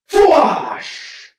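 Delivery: sharp voiced blaster whoosh; blaster zap. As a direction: spit out a laser blast. Second blaster shot.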